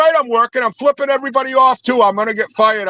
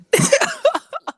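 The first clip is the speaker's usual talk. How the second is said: high cackle; 4 dB quieter than usual. Real, not acted. Laughs after road rage.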